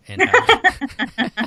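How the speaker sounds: words lost in a high burst of laughter; laughing. Real, not acted. Laughing at own family joke.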